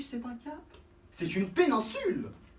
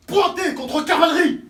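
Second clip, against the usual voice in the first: shouted, high parade-ground order; military command; 8 semitones higher, 11 dB louder than usual. Acted. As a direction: bark it at the troops.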